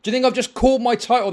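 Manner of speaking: angry demand. Real, not acted. Streamer demanding an answer from chatter.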